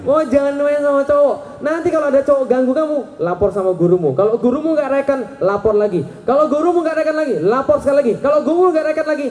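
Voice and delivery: high-pitched, hurried protective-dad instructions; insistent fatherly warning. Retold or imitated. Father telling his daughter whom to report boys to, hammed-up insistence. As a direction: drill it in urgently, step by step, hammed-up.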